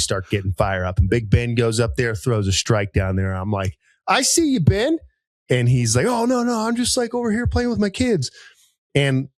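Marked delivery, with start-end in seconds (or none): 4.1-5.0 high-pitched playful shout-out, retold; mock-impressed, pitched way up; teasing call-out. Playfully calling out a bowling rival. 6.1-8.3 high-pitched innocent denial; mock modesty. Retold friend modestly denying he competes.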